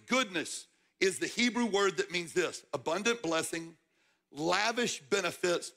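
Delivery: ordinary talk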